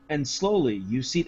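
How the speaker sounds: imitating a game master reading; hushed dramatic reading. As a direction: read it out like solemn flavor text, low and soft. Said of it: "he was doing a good job reading".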